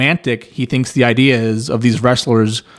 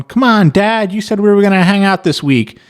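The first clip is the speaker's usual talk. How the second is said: put-on higher, let-down kid complaining to dad; a bummed-out kid reminding dad of his promise; whining kid; 8 semitones higher than usual. Disappointed kids complaining.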